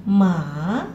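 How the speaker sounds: ma dipping then rising; third tone ma. Shown as a demonstration. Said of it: "kita tambahkan nada tiga di sini".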